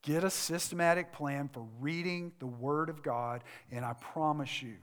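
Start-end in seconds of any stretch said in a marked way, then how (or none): none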